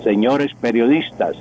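ordinary delivery

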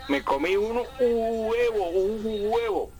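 high, slow, exaggerated hu-e-vo; mock wrong hiatus. Shown as a demonstration. Professor mocking coastal reporters' split, hammed-up high and slow. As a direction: ham up huevo split apart, pitched high. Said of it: "No puedo decir".

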